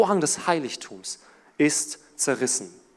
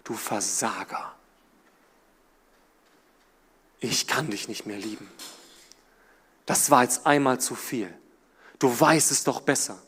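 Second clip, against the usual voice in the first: low, soft condemning voice; hushed, lower voice of condemnation; accusing inner voice; 6 semitones lower, 4 dB quieter than usual. Pretend. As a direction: speak as a cold, accusing voice. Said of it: "da ist eine Stimme".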